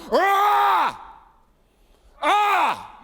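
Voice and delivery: loud high roar at death; roaring scream. Acted. Jesus at the tomb screaming at death, roaring. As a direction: let out a raging roar like a lion. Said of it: "He's literally screaming at death".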